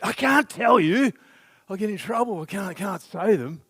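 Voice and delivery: put-on a child afraid of getting in trouble; anxious child. Daughter refusing to tell parents.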